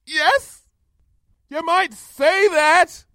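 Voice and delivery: high, drawn-out yell. A narrator's reading. Pupil on the tower answers the princess far below, yelling. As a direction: yell it back across the distance. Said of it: "I yelled".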